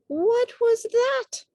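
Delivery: high, loud, slow startled cry; a baby bird crying out in alarm; high alarmed chirp. A narrator's reading. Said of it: "cried Flap".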